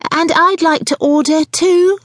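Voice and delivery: high, drawn-out singsong order; chirrupy. A narrator's reading. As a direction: chirrup it at the waitress. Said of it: "chirruped the woman with the buggy".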